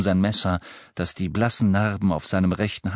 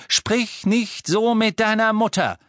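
bestimmt; 13 semitones higher than usual. Acted. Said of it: "erwiderte Mister Weasley bestimmt".

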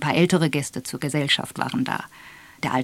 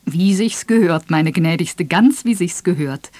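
lightly voiced gallant major, calling out; gallant exclamation; 5 dB louder than usual. A narrator's reading. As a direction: pay the lady a courtly, emphatic compliment. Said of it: "rief der Major galant".

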